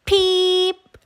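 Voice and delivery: high tiny peep; chick peep. A narrator's reading. Little chick answering, high peep. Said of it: "her chick said".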